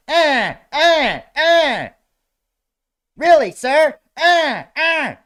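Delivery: high, loud malfunctioning robot voice; glitching robot. Pretend. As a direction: stutter like a hacked robot. Acting out a hacked robot.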